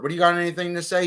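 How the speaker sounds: judge's question. Retold or imitated. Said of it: "what I told that judge".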